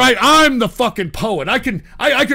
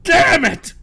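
exasperated outburst; 4 semitones higher, much slower than usual. Real, not acted. Streamer blows up at chat.

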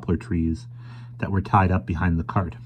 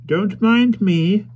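put-on slow, mild and high old man; politely mild, unhurried; mild old stranger; 14 semitones higher, 5 dB louder, much slower than usual. A narrator's reading. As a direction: speak as a gentle elderly stranger. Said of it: "said the stranger mildly".

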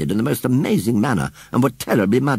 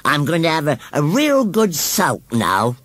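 roaring, sulky scarecrow; 7 semitones higher than usual. A narrator's reading. Scarecrow threatening the children, high and sulky. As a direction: announce it petulantly in a scarecrow voice. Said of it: "roared Gummidge".

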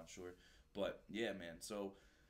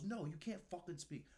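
angry retort; 9 semitones higher than usual. Retold or imitated. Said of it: "He goes".

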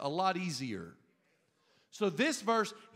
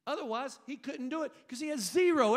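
ordinary delivery

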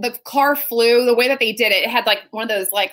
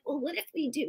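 soft, higher, tentative voice; small, unsure suggestion; hesitant second-guessing; 4 semitones higher, 13 dB quieter than usual. Retold or imitated. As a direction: imitate a doubter quietly floating a change. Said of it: "And then he's like".

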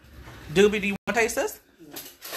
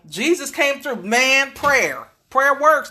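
excited; 8 semitones higher, 7 dB louder, slower than usual. Real, not acted. Celebrating the cobbler success.

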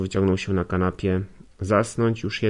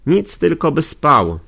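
loud, high, slow indignant exclamation; indignant cry; 6 semitones higher, 7 dB louder than usual. Acted. Nastazja scolding the sleeping lodger, loud and indignant. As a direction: scold him for sleeping, raising the voice. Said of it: "zawołała z oburzeniem".

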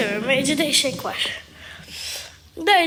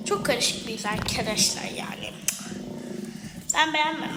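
hushed, unvoiced confiding remark; whispered aside; 3 semitones lower, 5 dB quieter than usual. Real, not acted. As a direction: whisper it like a secret to viewers. Whispered aside about avatar face.

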